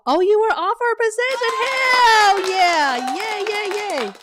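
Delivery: real squealing celebration; excited shout. Real, not acted. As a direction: cheer for the viewer's job offer. Viewer reports job offer.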